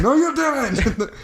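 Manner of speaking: high, slow, drawn-out rebuke; mock scolding. Roommate mock-scolding his girlfriend, slow and high.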